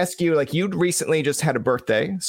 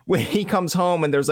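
usual speaking voice

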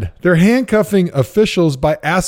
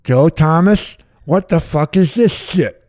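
Eric Mangini; 6 semitones higher than usual. Retold or imitated. Coach scolding Joe Thomas in a team meeting, thick lisp. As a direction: lisp heavily round a big wad of dip, exasperated. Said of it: "sounded like he had the worst lisp".

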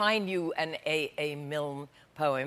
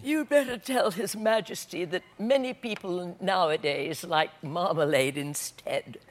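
a slow, sleepy cow drawling the last words; 3 dB quieter, slower than usual. Acted. Alderney cow answering the dairymaid, slow and sleepy. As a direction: let the cow nod off as she speaks. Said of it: "The Alderney said sleepily".

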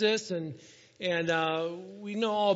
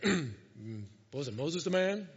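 much lower, put-on deep voice; [name] asking; 5 semitones lower, 3 dB quieter, slower than usual. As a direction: drop into a deep, ponderous mumble. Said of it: "Maybe he didn't do it like that".

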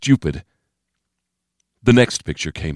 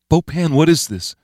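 higher-pitched character voice, questioning; a higher young-man voice against the narration; Steve's voice; 9 semitones higher than usual. A narrator's reading. Steve asking Bo Pan about the picture, puzzled higher voice.

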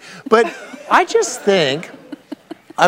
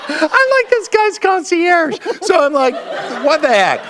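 laughing; 13 semitones higher than usual. Real, not acted. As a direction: laugh it out in disbelief. Laughing about being concierge.

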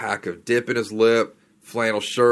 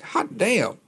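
pleased surprise; 11 semitones higher than usual. Retold or imitated. Catching a small rainbow trout.

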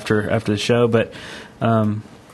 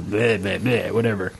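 dismissive; 5 semitones lower, 5 dB quieter than usual. Real, not acted. Trailing dismissive filler.

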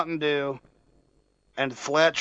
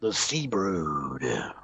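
deep slow dramatic voice; 10 semitones lower, 6 dB quieter, much slower than usual. Pretend. Dramatic slow name reading.

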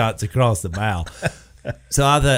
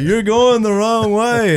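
higher, slower, louder mock movie voice; hammed-up movie quote, slow and raised; movie line impression; 8 semitones higher, 4 dB louder, slower than usual. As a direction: ham up the famous movie line slowly. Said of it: "They were like".

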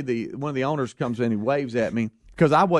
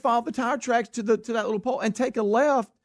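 high-pitched imitation of the exasperated owner; exasperated explaining; 7 semitones higher than usual. Owner repeats directions to lost driver, mock exasperation. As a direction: retell his instructions in a raised pitch.

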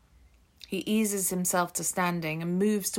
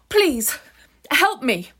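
loud, high plea for help; desperate cry for help; 7 semitones higher, 9 dB louder, slower than usual. Acted. Ruth shouting to the stranger for help, desperate loud plea.

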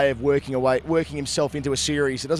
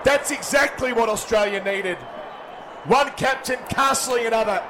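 cricket commentator; 7 semitones higher than usual. Acted. Live TV wicket commentary.

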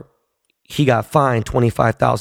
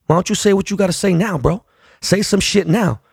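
higher-pitched, goading challenge; retold dare; 7 semitones higher than usual. Player confronting heckler behind him, goading. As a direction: goad him into repeating it, pitch raised. Player dares the fan to talk.

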